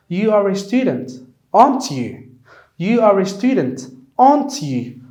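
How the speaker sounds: "aren't you?" stressed on "aren't", voice dropping at the end; falling intonation. Shown as a demonstration. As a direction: confirm what you already know, falling on "aren't you?". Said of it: "The intonation goes down".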